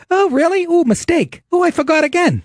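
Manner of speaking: high, squeaky mocking voice of a waitress; mock ditzy waitress. Mocking the waitress who keeps forgetting, high and squeaky.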